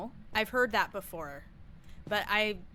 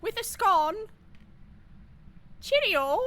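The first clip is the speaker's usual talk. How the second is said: hammed-up British accent, pitched way up; 12 semitones higher than usual. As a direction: trill it like a cartoon British host. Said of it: "That's my British accent".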